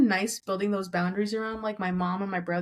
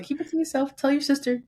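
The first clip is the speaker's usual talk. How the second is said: mock mom; 6 semitones higher than usual. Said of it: "just like".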